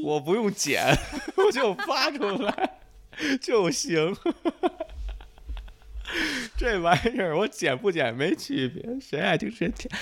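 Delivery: high, giggly talk through laughter; laughing. Real, not acted. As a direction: talk while cracking up. Laughing through gibberish joke.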